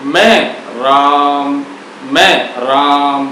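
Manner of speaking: मैं राम stretched slowly, the final म held closed; slow demonstration. Shown as a demonstration. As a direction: draw out मैं राम syllable by syllable. (धीरे-धीरे मैं राम, अंत का म बंद होठों से)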